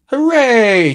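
put-on a happy children's cheer. A narrator's reading. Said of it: "cheered the children".

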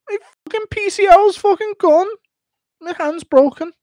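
squeaky defensive insistence; Darren Till excuse. Retold or imitated. Darren Till insists he let his daughter win, squeaky whine. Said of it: "Darren Till explaining".

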